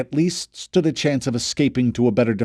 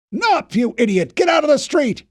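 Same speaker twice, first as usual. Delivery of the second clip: angry shout; 13 semitones higher, 4 dB louder than usual. A narrator's reading. Fisher shouting at Knopf.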